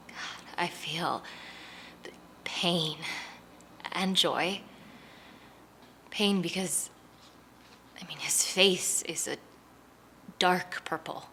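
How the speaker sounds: slow, hushed and halting with long pauses; pained. Real, not acted. Seeing the battered son at the door, pained. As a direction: let each word come softly, heavy with feeling. Seeing the neighbor's beaten face.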